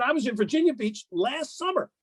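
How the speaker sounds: high, aggrieved mock golfer; imitating the player. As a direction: mimic a whiny player pleading his case. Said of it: "he said".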